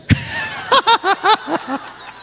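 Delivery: long, high peal of laughter; laughing. Real, not acted. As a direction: laugh helplessly at it. Laughing at checkbook zeros.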